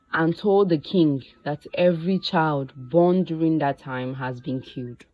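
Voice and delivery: usual speaking voice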